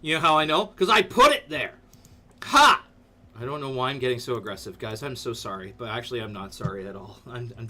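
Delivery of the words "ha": mock triumphant ha. Aggressive triumphant laugh.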